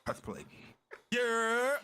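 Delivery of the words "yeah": energetic host intro; hype intro. Acted. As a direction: bring the hype.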